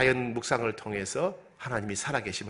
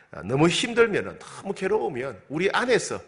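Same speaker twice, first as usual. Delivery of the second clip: ordinary talk, nothing marked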